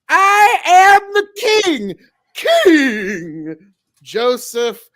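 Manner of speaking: high, loud, drawn-out royal proclamation; cartoon king's bellowed self-introduction; King John. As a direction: announce it grandly at a hammed-up shout. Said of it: "the King John from the animated line".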